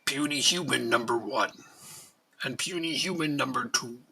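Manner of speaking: lightly voiced arrogant alien overlord; a hint of a scornful alien ruler addressing humans; Futurama alien overlord. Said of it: "Sorry, I've been watching Futurama a lot lately".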